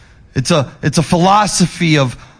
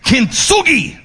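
Kintsugi in a higher Japanese accent; playful Japanese accent; 8 semitones higher, slower than usual. Shown as a demonstration. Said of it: "in your best Japanese accent".

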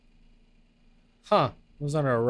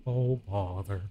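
low, soft, slow bear voice; Winnie the Pooh; 9 semitones lower, 5 dB quieter, slower than usual. Pretend. Guest at the party as a bear, low soft sigh.

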